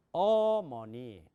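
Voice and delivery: 어머니 with the first syllable stretched, loud and slow; stress on the first syllable. Shown as a demonstration. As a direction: lean hard on the start of 어머니. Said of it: "강세가 있을 경우는". (첫 음절에 강세)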